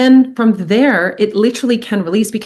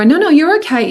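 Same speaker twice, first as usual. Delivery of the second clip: higher, louder no no, brushing it off; insistent dismissal; 8 semitones higher, 4 dB louder than usual. Retold or imitated. Parent dismissing a crying child, higher and louder. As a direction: hurry the crying kid along. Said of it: "the kid keeps crying and you go".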